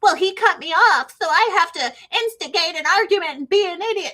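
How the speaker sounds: lightly mocked whiny driver; mocking road rager. Mocking road ragers who argue back, hammed-up whine. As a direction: sneer it as a dumb road rager. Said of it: "You're so stupid".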